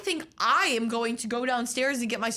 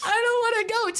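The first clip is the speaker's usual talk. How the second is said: higher, louder mock-tragic whine; mock tearful quote; 12 semitones higher, 6 dB louder than usual. To her friend, retelling the sad goodbye, mock tearful. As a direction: ham up the tearful farewell. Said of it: "And then I had to go from".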